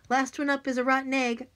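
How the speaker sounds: high, loud playground holler. Acted. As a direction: call it out racing up the stairs. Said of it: "I hollered".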